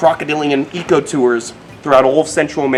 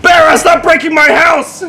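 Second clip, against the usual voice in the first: yelling at a dog; slow loud high yell; shouting; 14 semitones higher, 7 dB louder, slower than usual. Real, not acted. Calling to the dog outside.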